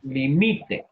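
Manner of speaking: "Limite" said li-MI-te, stress on MI; stress on the middle syllable. Shown as a demonstration. Teacher confirming the answer, stress on MI in "Limite". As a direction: hit the second syllable of "Limite". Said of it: "Palabra llana con pronunciación en la".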